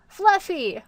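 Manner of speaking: put-on loud, high child's exclamation; high, eager kid voice; excited little kid. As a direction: blurt out the name like an eager kid. Voices the child giving name.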